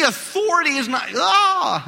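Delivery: strained voice cracking mid-word. Real, not acted. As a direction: voice breaking on a high shout. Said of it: "I'm losing my voice now".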